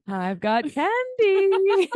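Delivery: high, slow, loud creepy lure; creepy man voice. Retold or imitated. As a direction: play a creepy stranger offering candy. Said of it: "creepy man with a van".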